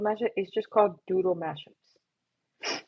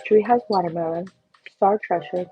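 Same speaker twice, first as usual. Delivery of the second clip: ordinary talk, nothing marked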